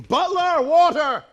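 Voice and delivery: loud high shout for the butler; calling out. Acted. Suitor in a frenzy, shouting loud and high for water. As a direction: yell for help across the house. Calls servant in crisis.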